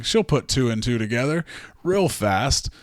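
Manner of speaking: normal talking voice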